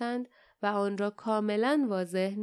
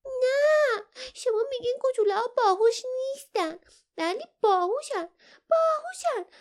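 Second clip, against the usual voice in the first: high-pitched, loud, tearful begging; pleading; 14 semitones higher, 5 dB louder than usual. A narrator's reading. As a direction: wail it in a high character voice, desperate to warn them. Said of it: "التماس کرد". (التماس با صدای زیر، بلند و گریان)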